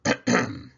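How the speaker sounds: loud throat clear before reading. A narrator's reading. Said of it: "Santa clears his throat".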